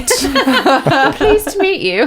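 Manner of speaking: high, very slow, laughing pleased to meet you; laughing polite kid. Retold or imitated. Kindergartner singing politely, imitated laughing high and slow. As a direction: mimic the suddenly polite child through laughter. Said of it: "very polite when they sing".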